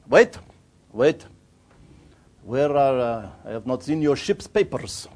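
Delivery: halting foreign-official voice, stopping someone leaving; Bulgarian harbour master. Acted. Border official halting a departing boatman, brusque. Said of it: "harbour master border control person".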